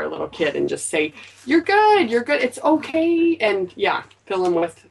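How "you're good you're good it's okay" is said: reassuring. Pretend. Reassuring a small child.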